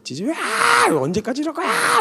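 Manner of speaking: high-pitched mock annoyed scolding; irritated mom nagging. Preacher imitates annoyed mother scolding sleeping child, high-pitched. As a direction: screech it like an exasperated mother. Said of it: "이러면서 막 신경질, 짜증을 엄청 내세요".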